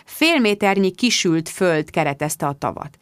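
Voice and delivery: usual speaking voice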